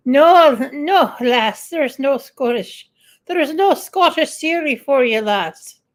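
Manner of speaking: hammed-up, badly faked Scottish; terrible Scottish accent. Telling a viewer there's no Scottish Siri, bad Scottish accent. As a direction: put on a corny Scots brogue. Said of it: "That's a terrible Scottish accent".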